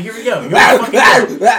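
high, loud, laughing-excited shout; hyped-up yell. Retold or imitated. Bartender sees confrontation starting, hyped high shout. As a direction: yell it as trouble kicks off.

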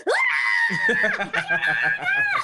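very high, loud squealing scream; excited scream. Retold or imitated. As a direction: shriek with joy at the big news, breaking into laughter. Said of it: "I was just screaming".

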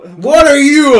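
high, loud acted disbelief; mock shocked shout. Reacting to a dude in maid costume, mock shocked shout.

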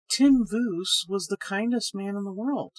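plain reading voice

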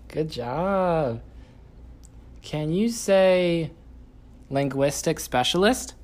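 loud, slow sing-song coaching. Real, not acted. Parent coaching toddler words.

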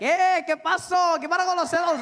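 strangers in Miami greet him in loud high Spanish; Miami guys in Spanish. Retold or imitated. Locals in Miami greeting him in high loud Spanish. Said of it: "they're like".

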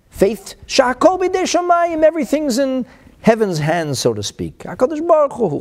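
loud, high declamation of sacred text; impassioned preaching. Acted. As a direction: declaim the quote and translation with rising force. Preaches quoted text at full force.

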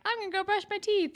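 high, fast bright announcement; quick high sing-song line; mock chirpy exit. Marco voiced, leaving.

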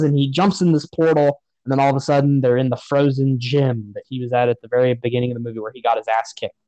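ordinary delivery

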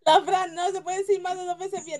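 talking through laughter; words breaking into laughs; laughing. Real, not acted. Cracking up over failed twister.

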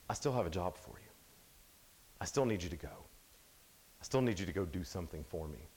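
God kindly renewing the call; patient God. Retold or imitated. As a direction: gently insist he still needs you. God patiently calls us again.

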